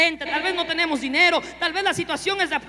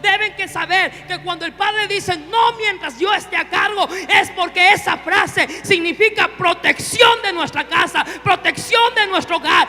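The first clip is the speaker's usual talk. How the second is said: forceful preaching; 5 semitones higher, 5 dB louder than usual. Acted.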